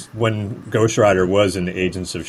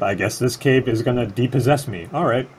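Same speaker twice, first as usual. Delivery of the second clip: normal talking voice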